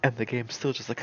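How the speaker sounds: usual speaking voice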